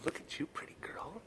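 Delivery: soft unvoiced whisper. Real, not acted. Keeper whispering fondly to a cat up close, hushed whisper.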